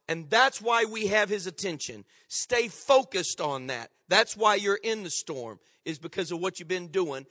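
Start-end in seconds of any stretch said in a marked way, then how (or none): none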